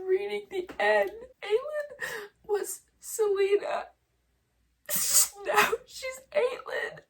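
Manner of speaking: high, wobbly, half-laughing sobs; choked up, laughing through tears; teary. Real, not acted. Telling viewers about the ending while crying. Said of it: "I can't stop crying".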